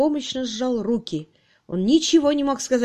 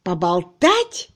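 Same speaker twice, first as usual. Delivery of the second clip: loud, drawn-out, incredulous roar; slow, thunderous question; menacing roar; 7 dB louder, much slower than usual. A narrator's reading. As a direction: throw the word back threateningly. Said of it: "взревел Кирк так грозно".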